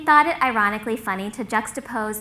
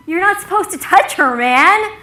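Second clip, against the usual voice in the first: high, loud telling-off; urgent reproach; 8 semitones higher, 9 dB louder than usual. Retold or imitated. Buddy correcting Logan for hugging her, urgent reproach. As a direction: set him straight, voice raised.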